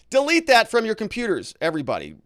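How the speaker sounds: loud, high joking order; hammed-up emphatic demand; mock urgent command. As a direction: call it out in pretend alarm. Begs chat to erase attempt.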